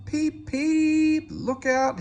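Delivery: high, loud panicked peeps; frantic warning whistle. Acted. Percy warns the yard while sliding, high frantic cry. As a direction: shriek a warning to everyone. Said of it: "he whistled".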